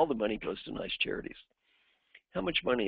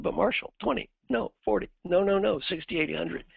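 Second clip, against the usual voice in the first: high, rushed mock haggling; a lifted voice climbing higher with each number; imitating eager bargaining; 8 semitones higher, 4 dB louder than usual. Employee bidding up his own fine, mock eager.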